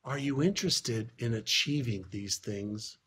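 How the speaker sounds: mentor leaning in. Retold or imitated. Mentor leans in to ask the boy, low and soft. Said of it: "He leans in and he says".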